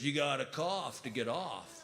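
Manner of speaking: low, soft, slow stoner voice; pot store clerk. Retold or imitated. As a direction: share it like lazy stoner wisdom.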